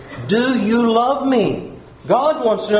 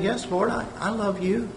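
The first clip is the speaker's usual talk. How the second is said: softer, humble yes; soft answer; 9 dB quieter than usual. Acted. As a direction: answer him gently and much softer. Peter answers Jesus softly.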